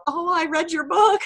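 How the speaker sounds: high-pitched, imitated nervous greeting with a laugh; mock-nervous, high little voice; nervous teacher. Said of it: "Like, all nervous".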